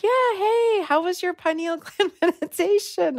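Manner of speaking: high-pitched friendly imitation; cheerful staff. Staff asking guest about her meditation, cheerful. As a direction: play chirpy staff asking after a guest.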